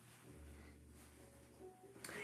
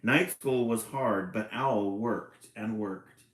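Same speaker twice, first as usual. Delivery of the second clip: put-on louder, even read-aloud voice for children; storybook narration; 7 dB louder than usual. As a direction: read it like a picture book to kids. Reading picture book aloud.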